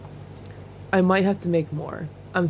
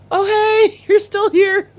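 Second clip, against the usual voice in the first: higher and louder, giggling; flustered excitement; 12 semitones higher, 7 dB louder, slower than usual. Retold or imitated. To the singer still beside her, flustered and giggling. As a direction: starstruck fluster with a laugh.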